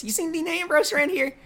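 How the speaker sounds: a high little Southern accent, mocking a wrestler; Jamie Noble Southern accent. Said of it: "His little voice".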